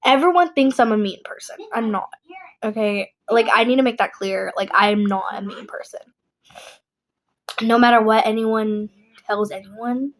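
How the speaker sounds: ordinary talk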